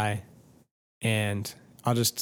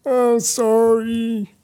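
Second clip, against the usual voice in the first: loud, high, drawn-out apology; calling through a wall; 13 semitones higher, 8 dB louder, much slower than usual. Retold or imitated. Crying guest calling an apology through the wall to the angry neighbor, loud high mock call.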